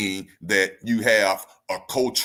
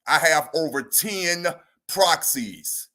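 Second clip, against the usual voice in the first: ordinary talk, nothing marked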